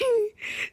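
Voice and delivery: high laugh breaking into a whimper; a laughing half-sob; laugh-cry. Real, not acted. Laugh-cry after self-pity.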